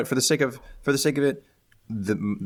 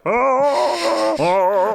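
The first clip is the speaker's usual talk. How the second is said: high, loud jungle yodel yell; Tarzan yell; 13 semitones higher, 6 dB louder than usual. Pretend. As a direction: call out like Tarzan swinging in. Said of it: "a Tarzan yell".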